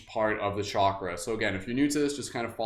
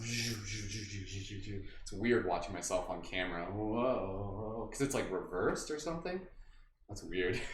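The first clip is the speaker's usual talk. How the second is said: soft, low muttered aside; quiet aside; 4 semitones lower, 8 dB quieter, slower than usual. Real, not acted. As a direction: murmur to yourself, half distracted. Aside while watching herself.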